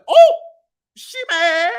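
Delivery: high, amused sass; sassy remark. Retold or imitated. To her date about jealous bartender, high sassy. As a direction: point it out with glee. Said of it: "I said".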